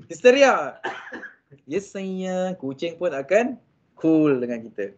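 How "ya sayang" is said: "Ya sayang" said much softer, gently; calm the hysterical cat with a gentle voice; soft reply. Shown as a demonstration. Soothing a hysterical cat at home, soft and gentle. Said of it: "Lagi power lembut".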